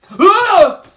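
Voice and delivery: high, loud shriek of fright; Big Mug is startled by a face. Acted. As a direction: jump back shrieking. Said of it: "cried Big Mug".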